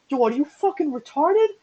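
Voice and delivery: high-pitched imitation of an appalled friend; mock-indignant retold question; incredulous outburst. Acting out friend's incredulous reaction.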